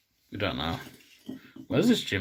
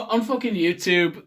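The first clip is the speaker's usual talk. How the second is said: ordinary delivery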